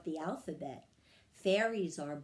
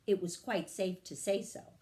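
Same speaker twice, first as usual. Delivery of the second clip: ordinary delivery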